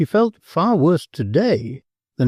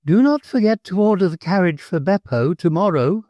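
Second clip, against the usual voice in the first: calling out; 8 semitones higher than usual. A narrator's reading. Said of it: "called out the Countess".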